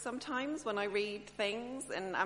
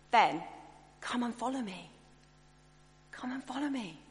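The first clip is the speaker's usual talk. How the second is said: a gentle, open, inviting call; warm invitation; 3 semitones higher, 4 dB louder than usual. Retold or imitated. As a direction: invite them in kindly, leaning out. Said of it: "more like Jesus spoke about this invitation".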